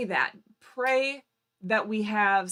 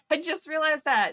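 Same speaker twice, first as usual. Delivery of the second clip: laughing; 8 semitones higher, 6 dB louder than usual. Real, not acted. Laughs at own phone mistake.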